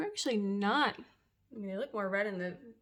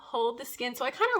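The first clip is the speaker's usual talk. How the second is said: normal talking voice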